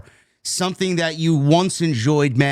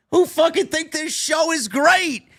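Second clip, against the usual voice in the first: exasperated rant; 11 semitones higher than usual. Real, not acted. Host rants at fans who defend the show, high exasperation. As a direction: pitch it way up and stretch the words. Ranting about the show's defenders.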